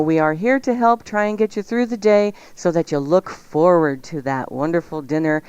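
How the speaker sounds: normal talking voice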